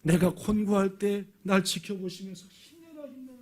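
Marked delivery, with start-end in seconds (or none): 2.5-3.4 very soft, high cheering under the breath; hushed encouragement. Acted. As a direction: cheer someone on secretly, barely audible. God quietly cheering him on unseen. (아주 작고 높게 속삭이듯 응원)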